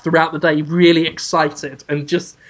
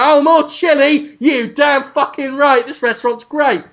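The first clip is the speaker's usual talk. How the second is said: excited; 7 semitones higher than usual. Retold or imitated. Ramsay voiced, excited.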